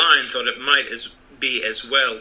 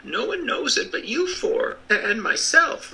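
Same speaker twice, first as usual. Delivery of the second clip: little man voice; 12 semitones higher than usual. Acted.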